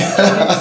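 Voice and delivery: light laugh. Real, not acted. Host laughing with the pastor over his titles, amused laugh. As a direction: laugh along at the joke.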